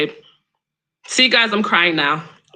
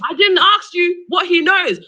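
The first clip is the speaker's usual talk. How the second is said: irritated snap; 6 semitones higher than usual. Real, not acted. Frustrated caller argues with guest.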